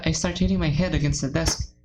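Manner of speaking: usual speaking voice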